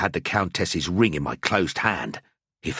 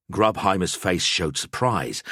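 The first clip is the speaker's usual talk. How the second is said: plain reading voice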